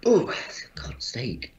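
stifled sneeze, whispered curse; suppressed sneeze. Real, not acted. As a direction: hold in a sneeze, then breathe out a hushed curse. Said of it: "That was a suppressed sneeze".

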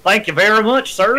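put-on southern accent. Echoing a friend's slip, mock southern accent. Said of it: "Well, that went southern".

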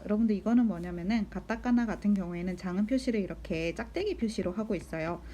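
normal talking voice

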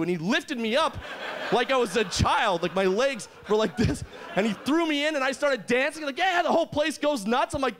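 usual speaking voice

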